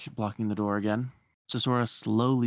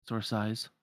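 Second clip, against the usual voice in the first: ordinary talk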